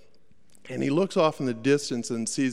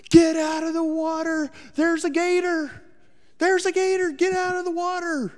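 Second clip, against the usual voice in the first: much higher and louder, a panicked mother's shriek; retold frantic scream; 13 semitones higher, 6 dB louder than usual. Mother screaming to her swimming son to get out, panicked shriek. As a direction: yell it like a mom who just saw a gator. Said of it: "she's just screaming to beat the band".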